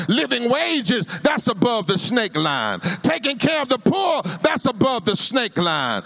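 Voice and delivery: rising, chanted sermon refrain; preaching at full force. Acted. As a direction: build the crowd with each "snake line".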